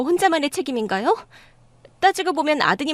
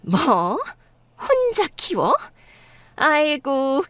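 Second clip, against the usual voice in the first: shocked disbelief; much slower than usual. Acted. Mother-in-law shocked at news.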